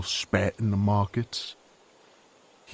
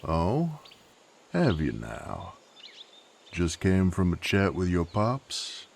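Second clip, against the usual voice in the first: usual speaking voice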